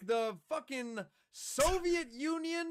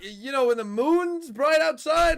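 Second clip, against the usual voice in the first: loud, over-the-top Italian man at dinner, lightly done; hype caster impression; 11 dB louder than usual. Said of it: "Why am I an Italian man at dinner".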